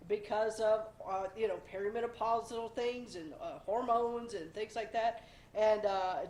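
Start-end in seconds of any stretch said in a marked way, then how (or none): none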